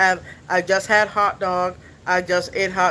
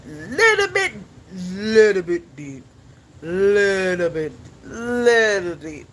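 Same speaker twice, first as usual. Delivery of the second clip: slow, drawn-out cartoon voice attempt; Squidward; slower than usual. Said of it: "Squidward is like".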